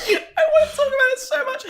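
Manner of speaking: high-pitched, laughing squeal. Real, not acted. Co-host desperate to discuss the film, laughing. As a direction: squeeze the words out through helpless laughter.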